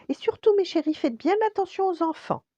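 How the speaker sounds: high, loud, fussing mother voice; high-pitched worried mum; mother crab. A narrator's reading. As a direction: warn the little ones in a high, insistent voice. Mother crab warns her young.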